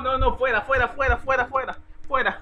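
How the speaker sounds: gritando fuera, fuera, muy fuerte; gritos de fuera. Real, not acted. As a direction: echarlos a gritos, repitiendo la palabra. Echando a alguien del chat. (grito fuerte de fuera, fuera)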